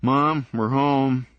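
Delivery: slow, loud call through the house. Acted. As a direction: call it out so Mom hears from another room. Calling out on entering house.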